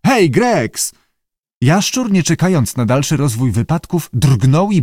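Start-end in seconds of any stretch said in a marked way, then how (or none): 0.1-0.9 high, urgent call; raised summoning voice. A narrator's reading. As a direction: call for someone across the room. Director calls for the keeper.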